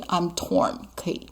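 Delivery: normal talking voice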